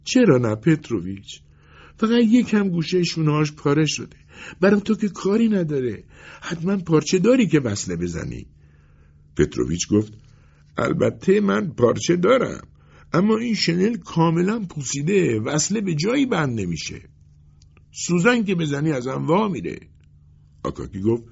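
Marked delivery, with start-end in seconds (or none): 0.0-8.5 high-pitched pleading voice; wheedling, imploring customer. A narrator's reading. 10.8-19.8 high-pitched tailor explaining it is hopeless; emphatic tailor's refusal; dismissive tailor. Acted.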